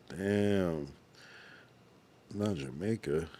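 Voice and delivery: ordinary delivery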